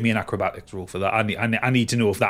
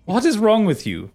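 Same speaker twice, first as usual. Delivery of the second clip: incredulous Minerva; 6 semitones higher than usual. Acted. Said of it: "she looks at you and goes".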